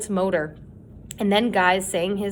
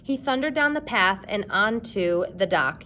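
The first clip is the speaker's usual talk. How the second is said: usual speaking voice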